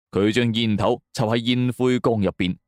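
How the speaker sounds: usual speaking voice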